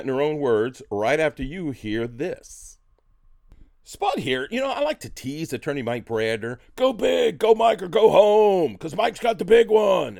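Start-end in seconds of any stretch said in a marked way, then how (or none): 3.8-6.6 ad read. Acted. Host reads a lawyer ad. 6.8-10.2 loud, high chanted slogan; shouted jingle. Retold or imitated. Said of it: "sing that little song".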